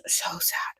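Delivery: breathy whispered lament. Real, not acted. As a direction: whisper it, still shaken. Overwhelmed by sad book ending.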